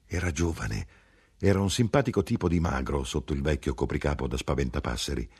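plain reading voice

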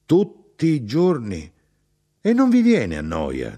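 high, loud, slow disbelief on the first words; aghast mother, voice shooting up; bewildered outcry. A narrator's reading. Mother shocked that they eat boiled meat daily, aghast. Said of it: "Mia madre era sconcertata".

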